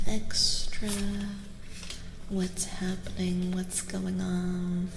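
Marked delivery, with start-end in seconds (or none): none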